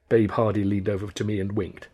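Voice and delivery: usual speaking voice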